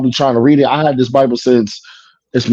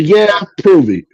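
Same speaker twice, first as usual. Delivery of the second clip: slow, high taunting challenge; challenging dare; 6 semitones higher than usual. Retold or imitated. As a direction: dare him to prove it, drawn out. Challenging the son in argument.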